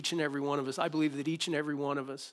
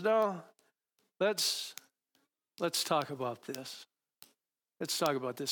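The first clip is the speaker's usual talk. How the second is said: calm gentle God voice. Retold or imitated. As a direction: settle him down gently. God answers calmly, softly.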